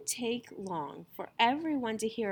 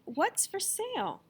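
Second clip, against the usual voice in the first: eager rich lady; 9 semitones higher than usual. A narrator's reading.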